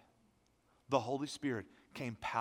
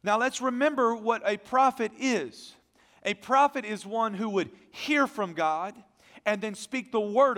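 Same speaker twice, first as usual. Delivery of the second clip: loud, high, ringing pulpit voice; full-force preaching; 9 semitones higher, 12 dB louder than usual. Acted. Pastor preaching loudly to his congregation, full force. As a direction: preach it out to the whole church.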